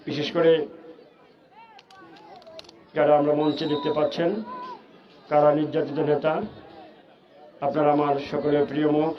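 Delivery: ordinary talk, nothing marked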